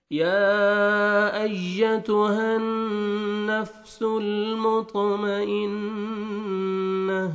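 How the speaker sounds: slow, high melodic tilawat; Quran recitation. Shown as a demonstration. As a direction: draw the vowels out in a recitation melody. Teacher recites Quran verse. (سریلی تلاوت)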